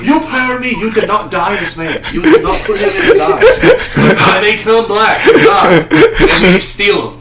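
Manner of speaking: lightly put-on Portuguese accent with a laugh; mock Portuguese accent. Portuguese slaver boasting to Japanese, mock Portuguese accent. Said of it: "Okay, I can do the accent".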